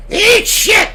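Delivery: loud high parrot squawk; defiant parrot squawk. Retold or imitated. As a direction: screech it back like a stubborn parrot. Parrot, defiant after freezer.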